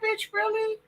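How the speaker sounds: incredulous. Retold or imitated. Reacting to the family incest news, high incredulous squeal. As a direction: shriek it in disbelief.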